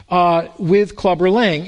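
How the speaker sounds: ordinary talk, nothing marked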